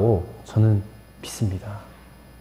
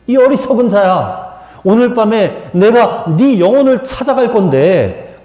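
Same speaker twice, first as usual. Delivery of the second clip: raised, higher-pitched divine reprimand; acted stern warning, pitched up; God's rebuke; 5 semitones higher than usual. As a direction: rebuke the fool as God, voice lifted. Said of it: "하나님께서 그 부자에게 말씀하십니다".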